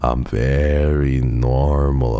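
slow, every word stretched out; slow, deliberate over-insistence; drawn-out insistence. Real, not acted. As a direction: draw out "very normal" with long vowels. Repeats claim, slowly drawn out.

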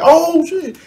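high startled oh shit. Retold or imitated. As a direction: jump at the sight. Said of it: "I turned around like".

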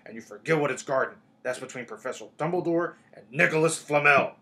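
loud, heated, booming Hagrid lecture; hotly. A narrator's reading. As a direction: warn them off forcefully, hot and loud. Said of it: "said Hagrid hotly".

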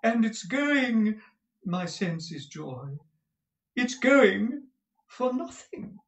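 high, slow, drawn-out rapture; affected, gushing husband. Acted. Husband coaxing his wife over a horse, affected high drawl. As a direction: drawl it grandly, swooping up high. Reads Mantalini's part in character.

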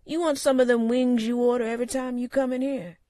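high, loud folksy bartender; gruff friendly barman. A narrator's reading. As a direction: offer it like an old regular's barman. Sam offers the usual order.

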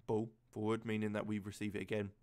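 ordinary delivery

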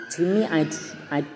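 usual speaking voice

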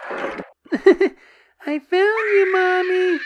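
put-on high, slow sinister child voice; creepy giggling child. Dead child finds mother.

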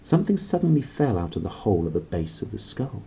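usual speaking voice

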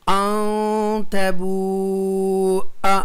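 अन तबूआ very slow, nasal and oo held long; recitation demo. Shown as a demonstration. Teacher shows students the reading, long-drawn अन तबूआ. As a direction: chant अन तबूआ with a short final syllable. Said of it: "ज़ैद अलिफ को नहीं पढ़ेंगे".

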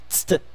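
tst as bare consonants with no real vowel; isolated consonant cluster. Shown as a demonstration. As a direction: fire off a short, soft tst. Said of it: "e depois tst".

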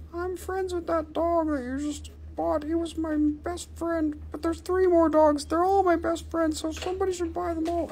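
squeaky little figure pleading for its friends; cat figurine voice. Pretend. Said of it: "that's enough marketing".

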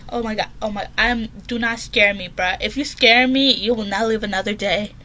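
ordinary talk, nothing marked

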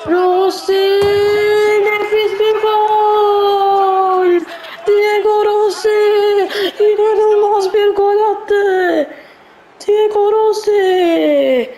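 loud, slow goal shout with a laugh; excited goal call. Real, not acted. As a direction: celebrate the goal like a commentator. Goal celebration in own commentary.

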